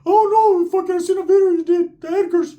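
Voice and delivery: very high, squeaky mock voice; high cartoon voice imitation; Ricky's voice. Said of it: "I said".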